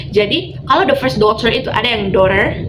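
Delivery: ordinary talk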